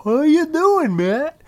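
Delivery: high, loud put-on greeting; mock relative's greeting. Mimicking family at mom's party, mock high-pitched greeting.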